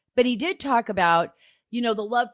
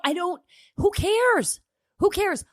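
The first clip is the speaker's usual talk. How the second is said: exasperated; 9 semitones higher than usual. Real, not acted. Exasperated outburst.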